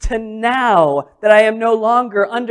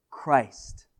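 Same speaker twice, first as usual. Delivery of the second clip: hushed Christ; 7 semitones lower, 10 dB quieter than usual. Acted. Climactic one-word answer.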